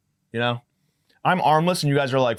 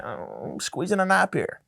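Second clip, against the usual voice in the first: sleepy mumble; 7 semitones higher, slower than usual. Retold or imitated. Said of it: "was like".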